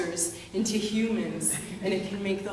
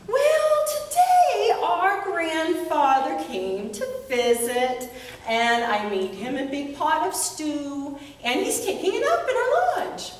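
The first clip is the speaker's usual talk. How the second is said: put-on much higher, quick, teasing girl voice; little sister; 7 semitones higher than usual. Girl hinting at her secret to her brothers, high and teasing. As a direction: tease the brothers in a high bright child voice.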